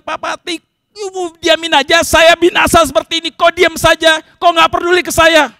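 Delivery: loud, high-pitched complaint; angry demand. Acted. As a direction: berate the one you need help from. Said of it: "tapi pakai marah-marah". (menuntut sambil marah-marah)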